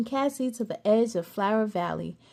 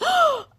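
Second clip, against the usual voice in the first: put-on sharp child gasp; 5 dB louder than usual. A narrator's reading. Child gasps at the fairy.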